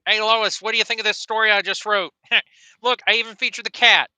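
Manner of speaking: high, nasal cartoon dad voice; goofy Peter Griffin impression. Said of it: "he's Peter Griffin".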